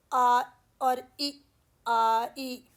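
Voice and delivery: आ and ई said slowly, one after the other; vowels separated. Shown as a demonstration. Teacher breaking a diphthong into its parts, slow. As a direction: pull आ and ई apart before gliding. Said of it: "दो vowel combined हैं".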